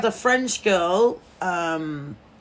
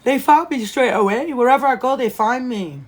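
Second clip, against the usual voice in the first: loud, higher exasperated complaint; 5 semitones higher, 6 dB louder than usual. Real, not acted. Telling viewers fans always find her, loud exasperation. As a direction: vent that they always find you.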